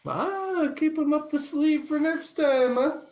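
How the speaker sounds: lilting high-pitched wink; playful sly tease. Real, not acted. Teasing sly suggestion.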